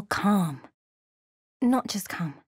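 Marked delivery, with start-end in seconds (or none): 1.6-2.3 whisper. Acted. Whispered mystic line.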